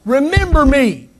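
high, stretched cry of pleading. Retold or imitated. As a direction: raise the plea to a high call. Thief's plea repeated with force.

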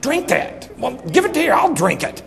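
high, strained exasperated outburst; aggravated. Retold or imitated. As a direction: act out fed-up impatience, pitched high. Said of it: "I get so aggravated".

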